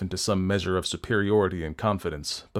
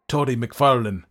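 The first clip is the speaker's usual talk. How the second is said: firm, raised call of a name; repeated herald's hail; 4 semitones higher, 5 dB louder than usual. A narrator's reading. Fettes repeating his call to the doctor, firm hail. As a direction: hail him by name, loudly. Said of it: "repeated Fettes".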